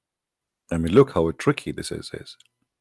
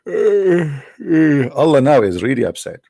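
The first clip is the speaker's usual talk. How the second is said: sighing, slow, high mock complaint; mock upset god; 6 semitones higher, 3 dB louder, slower than usual. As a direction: play an upset deity, whiny. Voicing God's annoyance mockingly.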